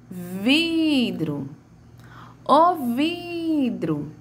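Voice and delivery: high, slow "vidro" with "vi" held long; "vidro" sung out on a long first syllable; stretched first syllable. Shown as a demonstration. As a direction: call "o vidro", dragging out "vi". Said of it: "qual é a sílaba que eu estendo mais".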